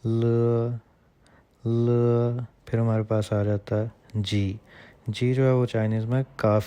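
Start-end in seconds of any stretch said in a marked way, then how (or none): 0.1-2.4 ल said as a clear l; tongue tip up for ल; ल, the Chinese l sound. Shown as a demonstration. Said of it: "इसको हम बोलेंगे".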